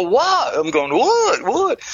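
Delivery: retold panic, voice jumping up high; freaked-out what. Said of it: "I'm freaking out when he does that".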